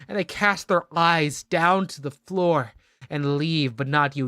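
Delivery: ordinary delivery, nothing marked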